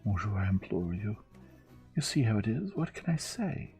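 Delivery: high, imploring French maid; appealing. Acted. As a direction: plead helplessly with the gentleman. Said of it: "threw out her hands appealingly".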